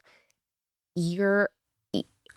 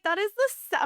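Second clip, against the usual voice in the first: put-on indignant child; 13 semitones higher, faster than usual. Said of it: "I was like".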